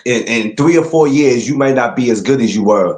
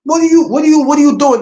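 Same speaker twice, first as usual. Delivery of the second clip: high, loud disbelieving yell; outraged yell; 5 dB louder than usual. Retold or imitated. Watching the referee stop Rolly's fight, loud high outraged yell. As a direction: scream it at the ref like the fight was stolen. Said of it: "I'm like".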